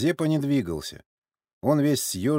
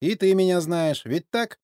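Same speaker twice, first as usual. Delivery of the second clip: high, needling question; veiled mockery; 9 semitones higher, 5 dB louder than usual. A narrator's reading. Sergei presses the nodding old man, veiled mockery. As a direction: press for agreement, slyly taunting. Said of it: "Со скрытой насмешкой".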